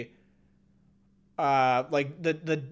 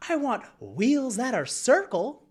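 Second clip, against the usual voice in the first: mildly higher, slow goofy quote; hammed-up eccentric delivery; Jeff Blim impression; 7 semitones higher, slower than usual. Streamer explaining his first try at the voice, mildly goofy. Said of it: "more of a Jeff Blim of".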